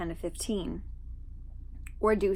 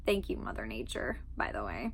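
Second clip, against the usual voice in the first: much lower, soft, dry thanks trailing into a whisper; sarcastic aside; 11 semitones lower, 9 dB quieter than usual. Real, not acted. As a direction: mutter the thanks as a wry aside. Sarcastic aside about allergies.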